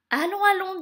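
high, loud urging; hurrying along. A narrator's reading. Fairy sending Cinderella to the carriage, urging. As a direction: chivvy them briskly.

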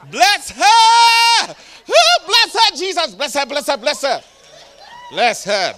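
high, loud fervent blessing, building; ecstatic prayer. Acted. Preacher laying hands on the woman, shouting blessings. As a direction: cry out blessings over her.

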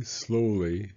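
ordinary delivery

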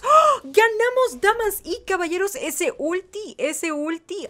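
high gasp into elated squeal, easing off; thrilled victory cry. Real, not acted. Player announcing a surprise win, thrilled cry. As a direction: exclaim in amazed triumph.

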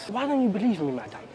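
ordinary talk, nothing marked

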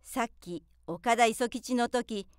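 ordinary delivery, nothing marked

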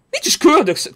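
high-pitched indignant retort; indignant denial. Real, not acted. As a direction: squeak it out in mock offence. Repeats denial, pitch jumps.

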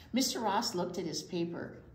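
plain reading voice

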